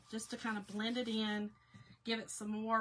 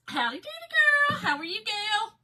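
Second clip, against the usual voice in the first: loud, higher, playful hello; gleeful greeting; 12 semitones higher, 13 dB louder than usual. Real, not acted. Vlogger greeting a friend joining the livestream, loud and gleeful. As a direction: holler a happy howdy to a friend. Greeting a viewer who joins.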